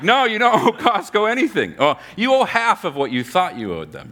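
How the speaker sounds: high, bright salesman pitch; cheery dealmaker. Retold or imitated. Manager cheerfully halving the debtor's Costco bill. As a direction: sell the discount like a friendly hustler.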